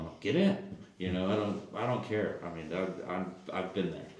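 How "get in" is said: high, louder burst of welcome; eager invitation. Retold or imitated. Owner inviting a kid into his car, eager invitation.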